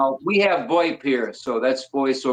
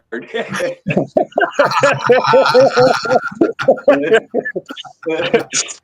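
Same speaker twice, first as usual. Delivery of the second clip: loud burst of laughter; 7 semitones higher, 7 dB louder than usual. Real, not acted.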